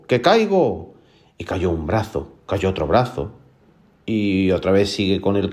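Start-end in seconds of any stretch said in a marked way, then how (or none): 0.1-0.9 higher warning cry, lightly acted; lightly voiced eerie call; mysterious voice. Voice warns Juan before a limb drops, higher mock warning cry. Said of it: "dice luego otra vez una voz".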